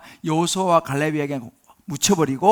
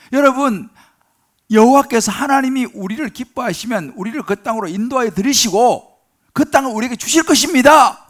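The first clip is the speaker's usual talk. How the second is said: loud, higher, impassioned exhortation; fervent preaching; 5 semitones higher, 7 dB louder than usual. Acted. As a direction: proclaim it with burning conviction. Preacher voicing Joshua, full force. (여호수아와 갈렙이 백성에게 외치듯 열정적으로)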